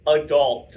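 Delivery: "adults" stressed hard as a word on its own. Real, not acted. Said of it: "emphasis on the word adults".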